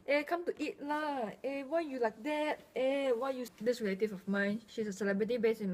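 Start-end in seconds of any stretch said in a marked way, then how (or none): 0.1-3.5 light Singlish street chatter; casual Singaporean Singlish. Retold or imitated. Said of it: "everybody is like".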